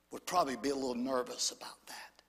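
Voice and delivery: hushed, confiding whisper; soft unvoiced aside. Real, not acted. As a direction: lean in and breathe it to them. Preacher drops to intimate aside.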